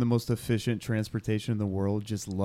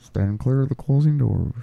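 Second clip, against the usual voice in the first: muffled train announcement voice; mumbled subway intercom; 4 dB louder than usual. Pretend.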